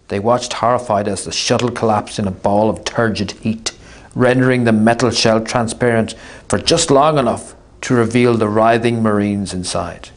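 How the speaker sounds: ordinary delivery, nothing marked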